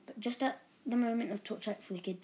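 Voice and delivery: plain reading voice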